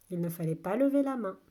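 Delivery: ordinary talk, nothing marked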